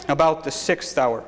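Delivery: ordinary delivery, nothing marked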